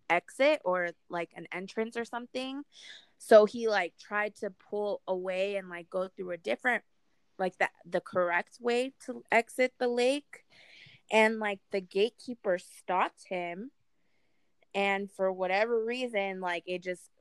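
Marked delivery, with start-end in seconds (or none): none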